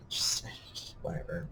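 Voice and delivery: unvoiced whispered give-up. Real, not acted. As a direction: let it drop in a whisper. Whispered giving up.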